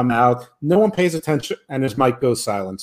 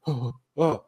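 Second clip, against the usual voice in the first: heartbroken; 7 dB quieter than usual. Retold or imitated. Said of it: "his heart was broken".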